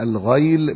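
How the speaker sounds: الغيل said "al-ghayl", ay after gh; الغيل with fatha, as al-ghayl; fatha on the ghayn. Shown as a demonstration. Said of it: "بفتح الغين".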